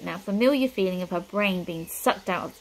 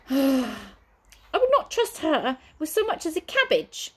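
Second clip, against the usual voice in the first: high, prim, excitable manservant; fussy butler voice; 8 semitones higher than usual. Acted. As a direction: play the jittery butler. Butler grumbles.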